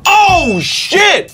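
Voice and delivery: high startled exclamation; mock surprise. Promoter realizing who the guest knows, high surprised outburst. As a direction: imitate a promoter caught off guard. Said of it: "He was like".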